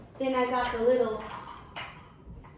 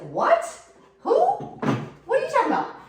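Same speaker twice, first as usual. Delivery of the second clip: high squeaky disbelief, much higher and louder; shocked husband; 6 dB louder than usual. Retold or imitated. Husband hearing the warning, squeaking in high loud disbelief. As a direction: play the husband caught off guard, voice jumping up. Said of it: "My husband's like".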